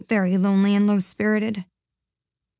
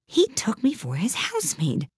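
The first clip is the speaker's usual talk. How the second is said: higher-pitched, taken-aback Alice; talking to herself; 7 semitones higher, 4 dB quieter than usual. A narrator's reading. Alice to herself as she runs, surprised. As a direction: remark to yourself in surprise. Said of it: "she said to herself as she ran".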